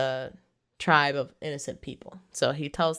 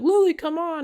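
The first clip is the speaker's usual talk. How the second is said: mock pleading; 13 semitones higher, 4 dB louder than usual. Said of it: "He's like".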